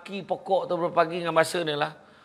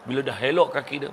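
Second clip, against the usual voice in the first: usual speaking voice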